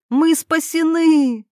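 high, drawn-out happy cry; smiling elation. A narrator's reading. Irina leaving his embrace, smiling elation. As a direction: exclaim it with a smile. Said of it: "улыбнулась Ирина".

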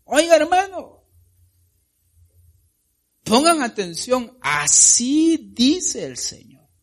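high, loud preaching cry; high, loud, slow preacher's shout to the church; preaching at full force. Real, not acted. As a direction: thunder it at the congregation.

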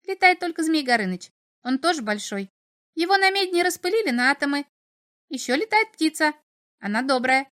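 high, chattering little imp. A narrator's reading. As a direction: rattle on like a cheeky imp.

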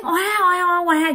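high-pitched mock infant wail; imitated baby crying. Storyteller mimics the baby heard in the dark, imitated crying. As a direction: mimic a baby's drawn-out cries. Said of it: "vẫn nghe cái tiếng khóc".

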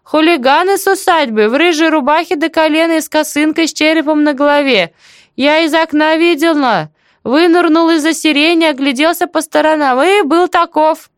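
very high, animated village-aunt voice; chatty deaf auntie, remembering. Acted. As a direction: recall it eagerly, pitched right up. Story figure: aunt describes hooligans.

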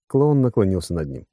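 usual speaking voice

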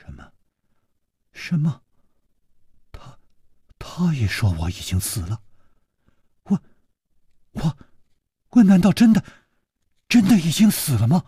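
a mild tremble, halting and stammering; a girl stunned and shaken, stuttering her words; trembling voice. Acted. Girl told everyone thinks she is dead, a mildly trembling stammer. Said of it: "她颤着声音说".

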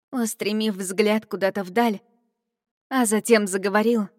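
ordinary delivery, nothing marked

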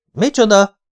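sharp high incredulous what; shocked. Acted. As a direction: jump up in disbelief. Empty coffin shock. (döbbent, magas felkiáltás)

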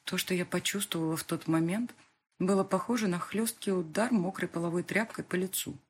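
usual speaking voice

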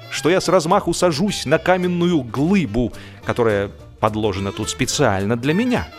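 plain reading voice

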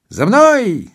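high, loud order to follow; commanding call. Acted. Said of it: "скомандовал водяник".

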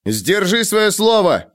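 slow, high shouted plea. A narrator's reading. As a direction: call it back, slowly. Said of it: "крикнул он".

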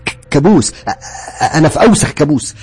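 frantic shouting. Acted. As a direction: break down, shouting and stammering.

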